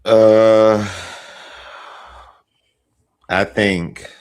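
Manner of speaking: tipsy. Real, not acted. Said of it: "I'm a little tipsy".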